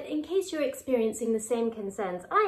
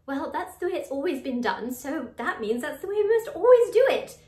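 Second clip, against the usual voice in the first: mock insistence; 5 semitones higher, 6 dB louder than usual. Voicing someone who refuses to change, mock insistent. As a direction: voice climbing higher and louder. Voices rigid thinking against curious questioning.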